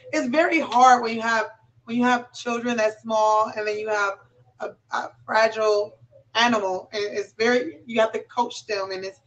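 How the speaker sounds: ordinary delivery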